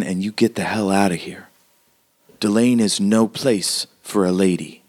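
emphatic, measured frontier woman's warning; hardened townswoman, urgent warning. Acted. Townswoman telling a lady to leave town, urgent warning. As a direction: press each phrase home like a tough local.

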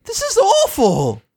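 distressed. Retold or imitated. Spike distressed.

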